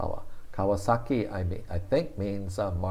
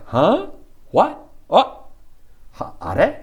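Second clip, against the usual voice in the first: much higher, louder startled huh, what; surprised exclamation; 11 semitones higher, 9 dB louder, slower than usual. Retold or imitated. Teacher acting a startled Japanese exclamation, surprised. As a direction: react as if caught off guard. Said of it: "like, say".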